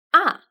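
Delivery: a falling sharply from high; fourth tone. Shown as a demonstration. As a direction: drop a down hard. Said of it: "The fourth tone is".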